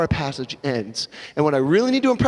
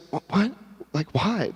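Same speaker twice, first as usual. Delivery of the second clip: sad; 6 dB quieter than usual. Acted. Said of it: "You might be sad".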